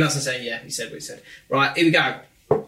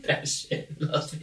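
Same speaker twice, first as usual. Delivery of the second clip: ordinary talk, nothing marked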